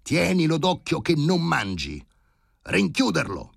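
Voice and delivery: high, loud shouted command; commanding high-pitched yell; thundering orders. A narrator's reading. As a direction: bellow instructions from another room. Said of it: "tuonò l'ordine dall'ambulatorio".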